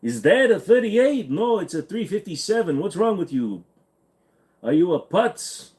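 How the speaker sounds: high, hammed-up Yiddish-style accent.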